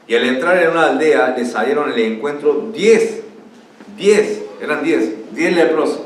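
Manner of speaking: emphatic, projected Bible reading; scripture reading. Acted. Preacher reading the lepers' story to the church, emphatic proclamation. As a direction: read it out to a congregation, stressing key words. Reads the scripture passage aloud.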